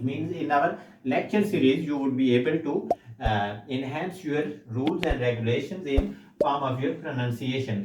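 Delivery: ordinary delivery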